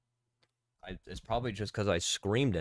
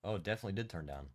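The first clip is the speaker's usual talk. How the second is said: normal talking voice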